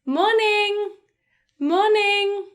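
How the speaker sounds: high, sustained friendly greeting; friendly high-pitched voice. Shown as a demonstration. Teacher showing a morning greeting for colleagues, friendly high-pitched voice. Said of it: "in quite a friendly, high-pitched voice".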